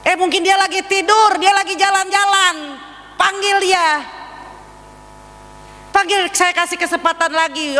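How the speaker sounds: mock the prophets in a high sneer; mocking taunt. Elia taunts the prophets of Baal with a high, mocking voice. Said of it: "mengejek ya".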